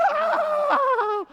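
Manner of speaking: high shocked scream; horrified scream. Retold or imitated. Jacob lifts the veil and sees Leah, high horrified shriek. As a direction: shriek at the sight under the veil. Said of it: "and goes".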